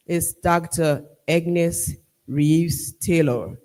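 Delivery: usual speaking voice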